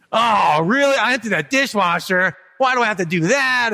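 put-on a child sighing at dad over the chore; whiny kid complaining. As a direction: play the put-upon kid who already did it. Child griping about the dishwasher.

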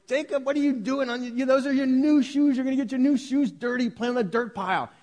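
high-pitched mock-alarmed dad calling to his son. Dad calls from the door to son on the dirt pile, high mock alarm. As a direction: call across the yard like a fussing dad.

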